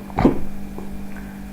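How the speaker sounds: sharp involuntary hiccup; a real hiccup jolting out. Real, not acted. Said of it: "You notice I'm hiccuping".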